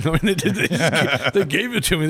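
real laughter breaking into the words; amused, laughing false start. Real, not acted. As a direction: talk through a laugh, pitch lifted. Laughing as he recalls the gift.

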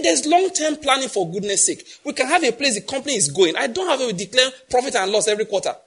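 Dell's retort. Retold or imitated. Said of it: "He said".

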